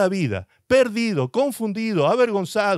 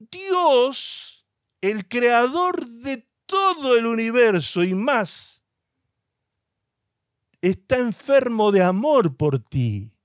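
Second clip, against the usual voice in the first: rising proclamation; 6 semitones higher, slower than usual. Acted. Preacher proclaims God's love.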